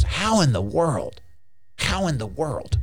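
mock bewildered devil; baffled devil. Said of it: "the devil goes".

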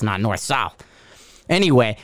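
normal talking voice

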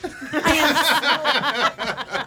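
ordinary talk, nothing marked

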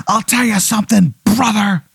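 high-pitched Hulk Hogan-style promo; growing menace, aimed at one heckler; the Menace's wrestler promo. Acted. Wrestler singles out the heckler, high promo voice. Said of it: "like an amalgamation of Ric Flair".

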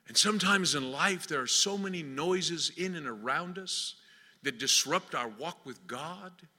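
ordinary delivery

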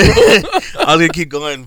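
giggling as he comes undone; laughing. Real, not acted. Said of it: "coming undone, bro".

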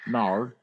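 "Nar" with an r-like Australian vowel. Shown as a demonstration. Echoing friends' mock Australian no, "Nar" with r-like end. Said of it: "That was an Australian no".

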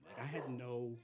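ordinary talk

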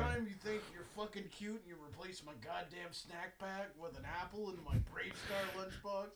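put-on loud rough kid rant; gruff dock worker hollering. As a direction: rant like a foul-mouthed dockhand. Kid yelling at mom.

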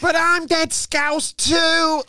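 high, very drawn-out Scouse whine; hammed-up Scouse newcomer pleading; mock Scouse. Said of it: "Every new acted Liverpool's like".

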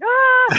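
high, loud comic scream; mock panicked yelp. Hanging up before the dinner, mock panicked yelp. Her retold panic after the call.

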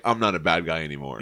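deep, fast, sulky voice; low Namor voice. Retold or imitated. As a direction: grumble it low and quick. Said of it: "Namor's like".

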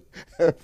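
a short burst of laughter at his own joke; laughing. Real, not acted. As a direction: laugh briefly after the punchline. Preacher laughs at own story.

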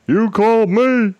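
high, slow, loud caller voice; mock a confused caller, high and drawn out; mimicking caller. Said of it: "They're like".

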